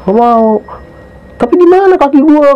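high-pitched wail, retold; imitated bawling. Retelling Mawar fretting about the leg, imitated bawling. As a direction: act out a distraught friend crying over a leg. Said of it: "Nangis, Bang, kejer".

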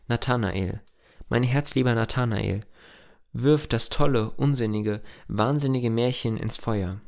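usual speaking voice

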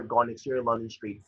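normal talking voice

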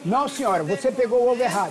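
usual speaking voice

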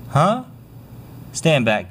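ordinary talk

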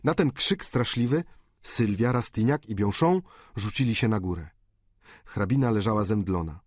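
ordinary delivery

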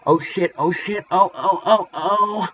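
hammed-up rising ohs of pleasure; mock climax. Acted climax inside the song.

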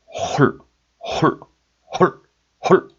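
홀 cut short, each one on its own; isolated quick bursts of 홀; deep, abrupt sound. Shown as a demonstration. Teacher demonstrating sounds said separately, 홀 clipped short. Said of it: "이 발음을 따로따로 낼 때는".

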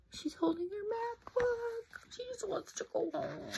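high, soft sympathetic aside; gentle pitying aside; tender aside. Real, not acted. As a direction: say it softly with pity. Sympathetic aside about Paisley.

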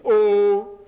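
loud, sustained "Oh"; vocative exclamation. Shown as a demonstration. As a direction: call out a long, emphatic "Oh". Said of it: "an exclamation in Arabic and Hebrew".

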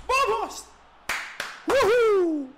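grito eufórico, muy agudo y fuerte; grito de gol. Real, not acted. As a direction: celebra el gol a pleno pulmón. Celebra un golazo. (grito de gol eufórico, muy agudo)

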